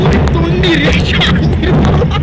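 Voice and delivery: high, loud screams of thrill; screaming on a roller coaster. Real, not acted. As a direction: yell for your friend mid-drop.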